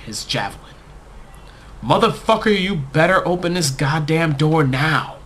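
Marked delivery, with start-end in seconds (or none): 1.8-5.2 high, loud screaming threat; furious scream. A narrator's reading. Fiancée threatening through the locked door, screaming high and loud. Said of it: "Her scream rang out".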